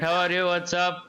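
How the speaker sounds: usual speaking voice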